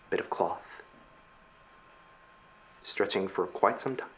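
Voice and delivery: low, hushed narration with long pauses; game master's scene setting. Acted. Game master shows players the wreckage, hushed narration. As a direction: set an eerie scene, unhurried and soft.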